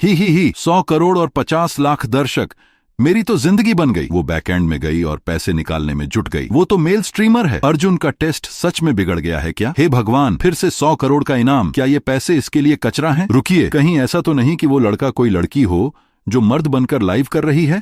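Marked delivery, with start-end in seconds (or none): 0.0-4.1 high-pitched, gleeful giggly voice; laughing it off. A narrator's reading. Streamer celebrating her hundred crore, giggly and high. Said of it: "लेकिन फिर हंसते हुए रुक गई". 6.5-17.8 high-pitched, agitated astonishment; shocked disbelief. A narrator's reading. Viewers baffled by a male streamer winning, shocked.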